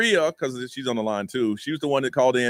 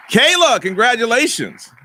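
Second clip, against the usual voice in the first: higher, louder, slow announcement; loud congratulations; 9 semitones higher, 7 dB louder, slower than usual. Real, not acted. Host congratulating the caller, loud and high. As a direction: proclaim the winner.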